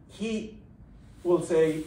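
ordinary talk, nothing marked

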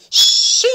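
efeito de vidro, soprado sem voz; tchim sussurrado e forte. Acted. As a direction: faz o som do golpe. Vidro acerta a borboleta. (tchim soprado, efeito sonoro)

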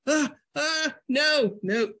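high, recoiling refusal; mock horror. Refusing cream cheese, high hammed-up horror. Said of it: "I look at that and go".

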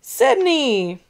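loud group-style yell of a name. Acted. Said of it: "Everyone else yelled".

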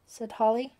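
ordinary delivery

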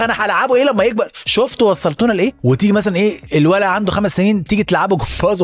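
ordinary talk

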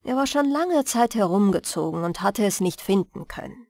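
ordinary delivery

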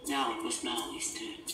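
ordinary delivery, nothing marked

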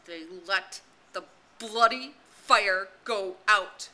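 slow, loud, bitter words; bitter curse. A narrator's reading. Ralph cursing the hunters to Simon and Maurice, slow bitter rage. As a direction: spit out the worst word he knows. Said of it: "the worst word he knew".

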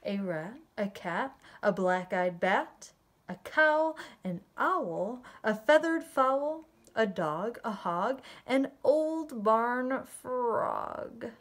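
put-on lilting read-aloud for young children; rhythmic storytelling, drawing out the rhymes; story narration. A narrator's reading. Reading a barnyard bedtime tale to children, story narration. Reads picture book aloud.

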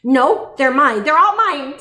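loud, high possessive storybook yell; building, high, loud tantrum; grouchy scream. A narrator's reading. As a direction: scream it like a greedy ladybug. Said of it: "screamed the great grouchy ladybug".

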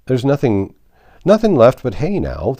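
loud, high complaint; loud messenger. A narrator's reading. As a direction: call it out plainly. Messenger answers.

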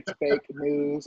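slow, drawn-out fake news; mild mock drawl. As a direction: imitate the president slowly. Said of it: "every time this man say".

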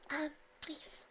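very high, soft, shy plea; timid. Acted. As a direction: add it shyly and politely. Timid pegasus adds politely.

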